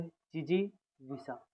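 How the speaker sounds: Jijivisha drawn out slowly, syllable by syllable; teacher slowly sounding out Jijivisha; slow spelling demo. Shown as a demonstration. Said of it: "अभी हम क्या बोले थे?".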